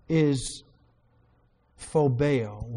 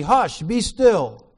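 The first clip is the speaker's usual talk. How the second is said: loud, slow, raised command; stern rebuke; 6 semitones higher, 9 dB louder, much slower than usual. Acted. Jesus commanding the wind and sea, stern rebuke. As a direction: rebuke it with drawn-out force. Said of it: "he rebuked the wind".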